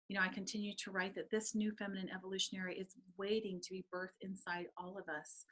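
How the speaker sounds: ordinary delivery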